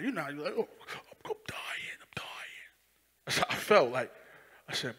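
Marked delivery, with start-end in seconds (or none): 0.6-2.7 whispered, fading breathless words; faint dying whisper. Retold or imitated. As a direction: barely get it out as if dying.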